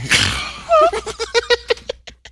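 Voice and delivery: long, high laugh. Real, not acted. Co-host jokes to listeners about being surprised, high laugh. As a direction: laugh high and loud at the joke.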